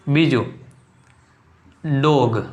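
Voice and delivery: normal talking voice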